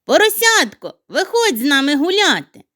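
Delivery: high loud call. Acted. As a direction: call him out to play. Snowflake calls the piglet.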